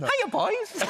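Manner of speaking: high, cheery muffled greeting; mum in rhino costume. Retold or imitated. Mum greets her sons from inside the rhino costume, high and cheery. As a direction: chirp it from inside a big costume. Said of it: "she went".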